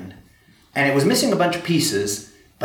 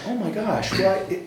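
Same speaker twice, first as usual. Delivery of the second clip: much higher mock-baffled exclamation; 8 semitones higher than usual. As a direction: throw it up high in bewilderment. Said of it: "And I was just like".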